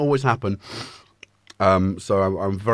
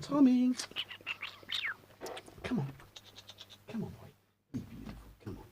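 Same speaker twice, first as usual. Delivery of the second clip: coaxing pet voice; 8 semitones higher, 10 dB quieter than usual. Real, not acted. Coaxing the cat to come.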